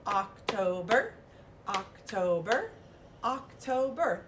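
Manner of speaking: slow syllable-by-syllable "October"; slow clapping-syllables model; clapped syllables. Shown as a demonstration. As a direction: beat out each syllable of October. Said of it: "how many syllables are in the month".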